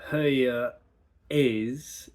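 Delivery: "Hey, uh, is" broken off hard with no linking R; abrupt stop. Shown as a demonstration. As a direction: chop off before "is". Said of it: "Instead of".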